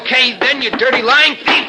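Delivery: high, loud, heated insult; furious accusation. Acted. Brother branding the other a thief, furious. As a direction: hurl it as the fight breaks out.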